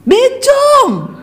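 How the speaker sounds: high, laughing mock insult; mocking jeer. Husband seeing his wife's new long hair, high laughing jeer. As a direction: squeal the insult through a laugh.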